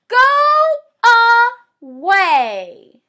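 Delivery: loud drawn-out shout of the locals; angry crowd shout. Acted. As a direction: yell it at the pest to leave. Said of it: "the locals would gather and shout".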